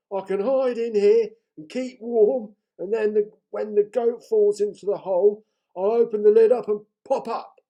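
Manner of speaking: the troll pleased with his plan; scheming troll. A narrator's reading. Troll voice in read story.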